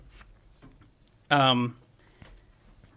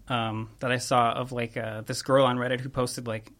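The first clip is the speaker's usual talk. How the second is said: ordinary talk, nothing marked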